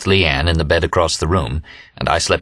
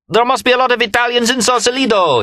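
very high, ringing full-throated cry; shouted. A narrator's reading. Remy repeats his friend's remark, top-of-lungs high shout. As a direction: shout it on a high held note. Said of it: "he shouted at the top of his lungs".

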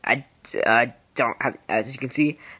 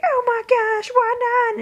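much higher, squeaky protest. Retold or imitated. Teen gamer protesting to adolescence in a squeaky voice. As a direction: squeak it out like a cartoon kid. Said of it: "And I'm like".

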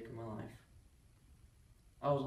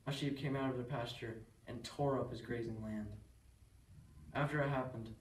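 usual speaking voice

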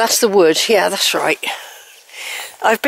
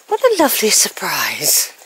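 whisper; 3 semitones lower than usual. Real, not acted. Greeting a horse up close, whispered. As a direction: hush it, close and gentle.

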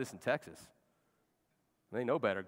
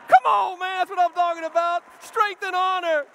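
fired-up shout; 13 dB louder than usual. Real, not acted. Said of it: "excited outburst".

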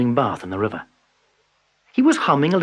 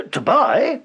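high surprised cut-in; interrupting; 10 semitones higher, 4 dB louder than usual. A narrator's reading. Peasant interrupting Tiger, high and surprised. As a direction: jump in, surprised. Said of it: "interrupted the peasant".

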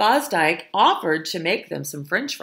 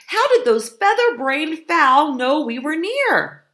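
high, loud, frustrated question; exasperated; 10 semitones higher, 6 dB louder than usual. A narrator's reading. Hungry fox wondering how the fowl knew, high exasperated cry.

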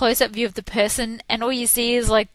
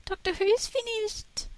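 mock wail; 9 semitones higher than usual. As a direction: wail it like a heartbroken fan, hammed up. Mourning end of Doctor Who.

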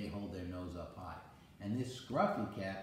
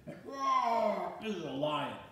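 big playful roar; lion roar; 10 semitones higher, 7 dB louder than usual. Pretend. Grandpa roaring like a lion for his grandson.

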